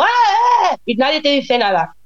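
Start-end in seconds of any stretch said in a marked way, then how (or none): 0.0-0.8 playfully imitating kids shrieking; imitated child screaming. Mother imitating her children in the street, mock screams. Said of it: "los niños están gritando".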